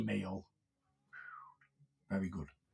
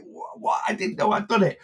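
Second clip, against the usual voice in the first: whispered "what?", then higher in disbelief; retold baffled reply; 6 semitones higher than usual. Retelling how he answered the angry client, baffled. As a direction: stammer in mock shock, then plead innocence. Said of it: "I'm like".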